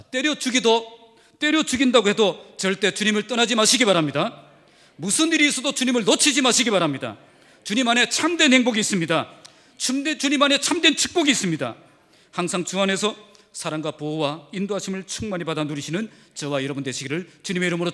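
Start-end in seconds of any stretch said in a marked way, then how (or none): 0.0-11.8 loud pleading exhortation; the pastor urges his flock to stay, loud and fervent; fervent preaching. Real, not acted. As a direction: urge the congregation to stay, loud and firm. Preaching at full force.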